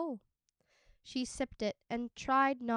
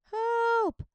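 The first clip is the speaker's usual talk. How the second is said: whimpering; 12 semitones higher, 9 dB louder than usual. Acted. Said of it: "a muffled voice whimpered".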